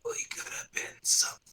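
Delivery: whispered last words, quick and soft; dying whisper. Acted. Dying Fruby looks at you and goes, faint whisper. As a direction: breathe it out with no voice.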